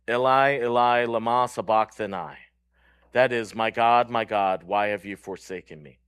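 normal talking voice

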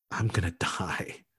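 breathless frightened whisper; terrified whisper. Retold or imitated. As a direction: whisper it like a man who fears for his life. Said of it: "he is so terrified".

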